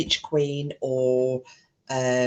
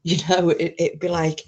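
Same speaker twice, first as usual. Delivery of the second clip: normal talking voice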